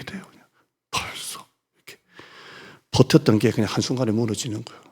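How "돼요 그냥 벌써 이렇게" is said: hushed. Retold or imitated. Pastor acting out collapse.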